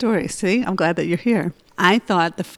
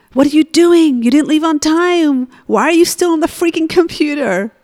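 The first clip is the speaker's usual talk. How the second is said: higher, louder angry yell at her son; retold yelling; 8 semitones higher, 5 dB louder than usual. Mother yelling at her son for missing class, angry. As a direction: snap at him like a panicked mother. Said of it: "I started yelling at him".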